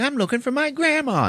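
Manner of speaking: very high mock girlish voice; girl's voice. Liz answering the priest, in a high mock girl voice.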